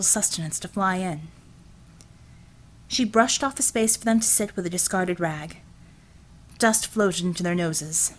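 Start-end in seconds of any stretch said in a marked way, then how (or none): none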